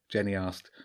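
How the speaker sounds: plain reading voice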